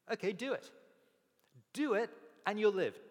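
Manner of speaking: loud, high, insistent urging; emphatic command. Retold or imitated. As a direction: push the words out like a firm command. Jesus answers lawyer emphatically.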